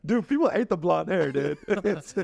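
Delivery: laughing while talking. Real, not acted. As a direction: chuckle through the words. Laugh heard while speaking.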